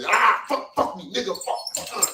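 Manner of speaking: mock breathless moaning, starting high; hammed-up gasping ecstasy; woman mid-climax. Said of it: "Women, women mid-coitus, like, mid-cum".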